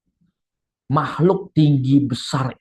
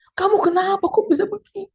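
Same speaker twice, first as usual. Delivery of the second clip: high, alarmed questions; startled friend asking what is wrong; shocked concern; 4 dB louder than usual. Retold or imitated. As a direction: gasp out worried questions.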